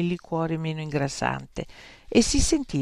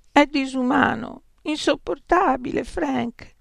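moaning; 10 semitones higher than usual. A narrator's reading. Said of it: "gemette Beatrice".

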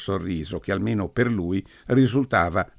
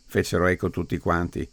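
ordinary delivery, nothing marked

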